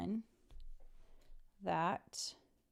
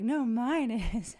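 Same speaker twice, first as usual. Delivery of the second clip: mock rival retort; 3 semitones higher, 8 dB louder, slower than usual. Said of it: "she's like".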